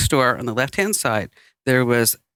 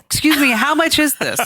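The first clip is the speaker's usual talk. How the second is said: mock polite question; 9 semitones higher than usual. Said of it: "he says".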